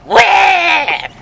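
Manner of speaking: high, loud imitated baby wail; mock wail. Dad mimicking the baby, a high loud wail. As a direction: howl it way up high like an infant. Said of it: "And I go".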